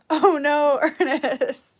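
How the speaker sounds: loud laughing oh no. Real, not acted. Streamer cracks up at a viewer's joke, loud laughter.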